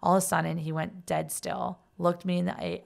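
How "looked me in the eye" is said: ordinary talk, nothing marked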